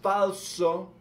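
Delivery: high, loud, slow call to the faithful; fiery preaching. Acted. Vlogger urging viewers to cry God's name, in a high, loud preaching voice. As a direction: preach it out, stretching the key words.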